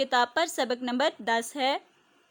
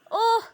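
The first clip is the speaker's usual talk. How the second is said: sharp high ouch, a pained yelp; cry of pain; 7 semitones higher than usual. A narrator's reading. Boy falls and hurts his knee, cries out high in pain. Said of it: "Hasan दर्द से चिल्लाया".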